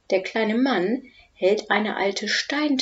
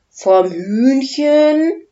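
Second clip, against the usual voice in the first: baffled; 7 semitones higher, 8 dB louder, much slower than usual. Acted. Udo still baffled.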